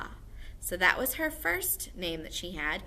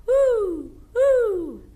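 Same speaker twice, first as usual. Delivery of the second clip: high, loud hooting owl; 14 semitones higher, 12 dB louder than usual. Shown as a demonstration. Teacher shows the class an owl's hoot. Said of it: "Owls hoot".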